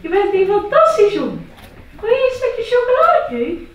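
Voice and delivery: high, loud, gushing mum; doting mother. Acted. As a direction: coo over him like a proud mother. Mother praising son.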